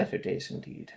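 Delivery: soft, breathy murmur; quiet wistful echo. Acted. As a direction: say it under your breath.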